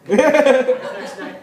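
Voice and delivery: slow, loud retreat; flustered cop. Retold or imitated. Cop backing away, slow and loud. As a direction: back off awkwardly, drawn out. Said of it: "he's like".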